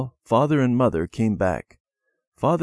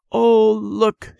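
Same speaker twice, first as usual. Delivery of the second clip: put-on high, louder child's cry of dismay; alarmed cry; 12 semitones higher, 6 dB louder than usual. A narrator's reading. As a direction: cry out at the snapped branch. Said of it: "she cried".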